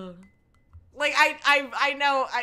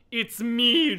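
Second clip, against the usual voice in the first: loud, raised cut-in; interrupting; 5 semitones higher, 7 dB louder than usual. Acted. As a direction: jump in forcefully. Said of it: "I interrupted her".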